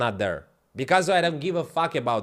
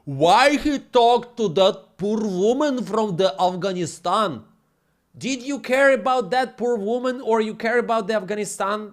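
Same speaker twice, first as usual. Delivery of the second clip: mocking offended viewer; 6 semitones higher, 5 dB louder than usual.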